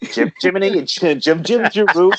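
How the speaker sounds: a weak, half-hearted Cockney sing-song; singing in a clumsy Cockney accent; bad Cockney accent. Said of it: "my Cockney accent is pretty bad".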